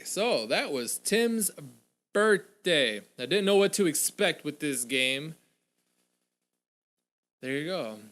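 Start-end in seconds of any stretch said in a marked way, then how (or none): none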